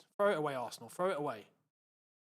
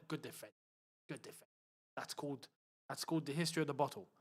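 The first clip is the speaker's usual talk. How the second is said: under-the-breath mocking remark; dry, quiet sarcasm; muttered sarcasm; 7 semitones lower, 11 dB quieter than usual. Real, not acted. Mutters sarcastic praise at rivals.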